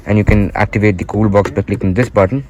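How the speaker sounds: ordinary talk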